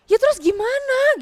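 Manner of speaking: high, loud, slow exasperated snap; loud frustrated retort; sister snapping back. Retold or imitated. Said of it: "agak membentak aku".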